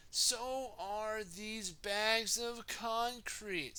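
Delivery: high, drawn-out character line; storybook Dizzy. Acted. Storyteller voicing Dizzy with bags of concrete, storybook character voice. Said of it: "said Dizzy".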